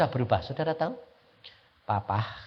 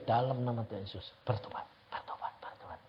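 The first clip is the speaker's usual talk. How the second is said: soft whispered prayer, much softer; 3 semitones lower, 9 dB quieter, faster than usual. Shown as a demonstration. Husband whispering a prayer beside his sleeping wife. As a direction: whisper the command over a sleeping spouse. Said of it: "Pelan-pelan".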